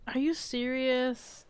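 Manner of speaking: ordinary talk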